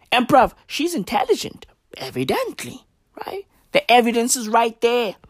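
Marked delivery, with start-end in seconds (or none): 2.0-3.5 whisper. Real, not acted. Whispered aside in rant.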